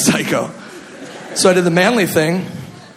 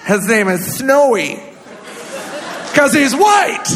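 put-on higher Minnesota mom accent, building to a cry; mom's Minnesota accent; 3 semitones higher than usual. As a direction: gush about the new cat in a Minnesota accent. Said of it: "She's that stupid accent too".

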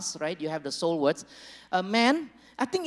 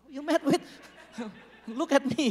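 higher, laughing mock friend; 5 semitones higher, 3 dB quieter, faster than usual. Pastor voicing the woman's friend, higher and laughing. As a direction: chuckle through it, leaning in. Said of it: "the friend is like".

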